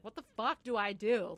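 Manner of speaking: loud punch on the swear word; exasperated helplessness. Retold or imitated. Husband unsure how to help her, exasperated. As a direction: burst out, at a loss. Lachlan voiced at a loss.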